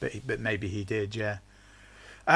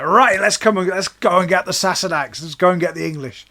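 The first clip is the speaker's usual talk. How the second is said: louder, higher rallying shout; rowdy Scots war cry; 7 semitones higher, 11 dB louder than usual. Retold or imitated. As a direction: rouse the troops to charge. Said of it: "And the Scots are like".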